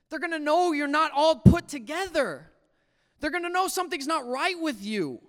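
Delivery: high, forceful scolding inner voice. Retold or imitated. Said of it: "this voice in the back of my head said".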